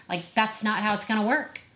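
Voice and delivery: usual speaking voice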